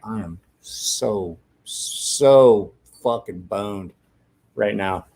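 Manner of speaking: slow, resigned self-talk; drawn-out dread. Retold or imitated. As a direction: stretch each word out, sinking into dread. Retold inner dread on seeing dad.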